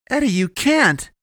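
high, drawn-out desperate shout; loud, high pleading call; crying out after someone. A narrator's reading. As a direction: yell after him to stop him. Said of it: "Avery cried after him".

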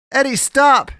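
high, very loud desperate shout; urgent full-voiced cry. A narrator's reading. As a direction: yell to halt him. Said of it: "she cried".

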